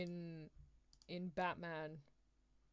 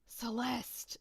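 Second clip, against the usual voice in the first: loud, raised call of the name; trial character voice; 4 semitones higher, 5 dB louder than usual. Shown as a demonstration. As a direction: try out a louder, higher character voice. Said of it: "gonna try all different voices".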